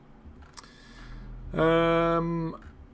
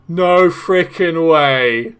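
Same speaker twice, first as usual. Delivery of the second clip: loud, very slow, drawn-out disbelief; amazed disbelief; 8 dB louder, much slower than usual. Real, not acted. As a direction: stretch every word in amazement. Amazed at the mod.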